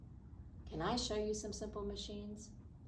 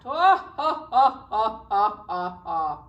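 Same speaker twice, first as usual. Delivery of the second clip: big lion laugh; 4 semitones higher, 14 dB louder than usual. Acted. The lion laughs at the little mouse, booming. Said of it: "Now the lion laughed".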